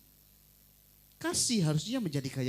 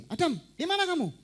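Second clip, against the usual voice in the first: high, loud, harsh calling out of Adam's name; harsh angry demand; 10 semitones higher, 5 dB louder than usual. Shown as a demonstration. Preacher shows how God did not speak to Adam, with a harsh loud demand. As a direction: bark the question at Adam like an accuser. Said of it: "Nggak ngomong gini".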